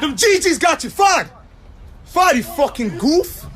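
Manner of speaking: taunting shout. Real, not acted. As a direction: yell it out to mock a rival. Taunting a rival rapper.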